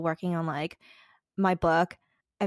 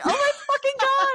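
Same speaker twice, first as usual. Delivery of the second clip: a high, loud, shocked "oh my God"; shocked outcry; 6 dB louder than usual. Retold or imitated. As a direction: react in stunned disbelief, loud and high. Shocked reaction to friend's confession.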